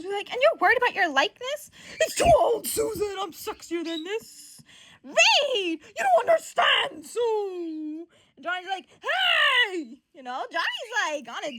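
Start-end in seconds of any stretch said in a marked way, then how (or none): 0.3-4.6 indignant bickering. Acted. Susan answering complaint about likeness, high indignant bickering. 5.0-8.1 high, loud, drawn-out complaint; exasperated protest. Acted. Arguing with Reed and Sue, high exasperated protest. 9.0-10.0 loud, high-pitched shout; indignant call. Acted. Johnny calling the others' attention, high loud shout.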